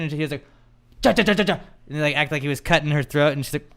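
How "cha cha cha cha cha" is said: higher, punchy repeated jabs; staccato stabbing sound effect; stabbing noise. Retold or imitated. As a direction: imitate a knife jabbing over and over. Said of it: "making, like, a stabbing motion".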